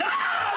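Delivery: high sudden yelp of shock; startled yelp. Acted. The wife startled on the street, high yelp. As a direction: yelp like something grabbed you.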